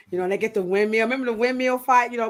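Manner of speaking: ordinary talk, nothing marked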